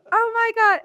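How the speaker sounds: put-on loud, high yelp in own accent; mock panic. Retelling her own reaction to the bat, mock panic.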